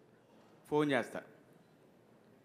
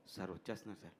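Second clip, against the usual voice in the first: much softer, timid reply; meek assistant; 6 semitones lower, 13 dB quieter, faster than usual. Retold or imitated. Assistant answering the angry director, very soft and meek. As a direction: shrink back and answer softly.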